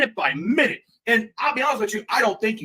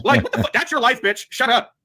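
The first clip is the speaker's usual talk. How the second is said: ordinary talk, nothing marked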